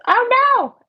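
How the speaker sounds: high, loud oh no; dismayed cry. Real, not acted. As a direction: caught ruining the painting. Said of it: "As I say that".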